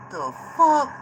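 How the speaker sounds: sudden high startled blurt. Real, not acted. Filming the sky, startled by what he sees, high surprised blurt. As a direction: caught off guard, high and sharp.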